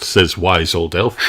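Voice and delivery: usual speaking voice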